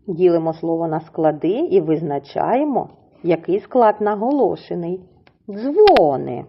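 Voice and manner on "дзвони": "Дзвони" with stressed Дзво-, DZVO-ny; stress on first syllable. Shown as a demonstration. Teacher asking which syllable is stressed, stress on first syllable. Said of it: "наголошуємо на перший склад".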